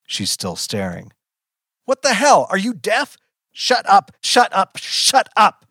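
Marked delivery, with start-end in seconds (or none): 1.8-5.6 high, loud, repeated bursts; hysterical yelling. A narrator's reading. Child screams at staring mother, losing control. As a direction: yell as if she can't hear you.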